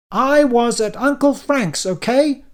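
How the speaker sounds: loud, high, slow and far-off yell; continued yelling. A narrator's reading. Dan yelling back at his accusers. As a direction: keep shouting, defiant. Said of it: "I continued yelling".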